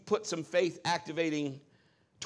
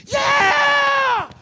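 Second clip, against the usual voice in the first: put-on high, loud child's cheer; triumphant shout; 13 dB louder than usual. Daughter after her crash, shouting for joy. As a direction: shout it like a kid who just did it. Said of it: "rolls over and goes".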